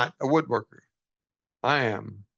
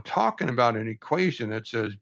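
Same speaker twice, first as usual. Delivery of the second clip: ordinary delivery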